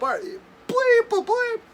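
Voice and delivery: very high, squeaky bleeped question; mock grumbling curse. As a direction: keep cursing while climbing out. Friend still cursing climbing out.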